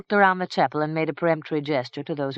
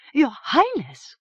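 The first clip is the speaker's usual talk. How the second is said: high, slow and louder, shocked; aghast cry; 10 semitones higher, 5 dB louder than usual. A narrator's reading. Priest cries out to the king, aghast and higher. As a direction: cry out in dismay. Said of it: "cried Father Umfred aghast".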